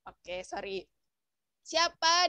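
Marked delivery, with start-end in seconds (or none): 0.0-0.8 muted mic. Real, not acted. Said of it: "Kak Reni suaranya di mute".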